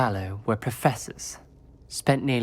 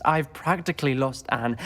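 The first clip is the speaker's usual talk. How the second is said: usual speaking voice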